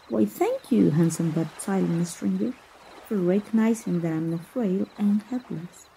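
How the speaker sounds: ordinary talk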